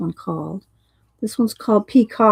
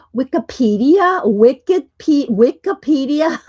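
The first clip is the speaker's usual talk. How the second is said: playful chat name; 6 semitones higher, 7 dB louder than usual. Real, not acted.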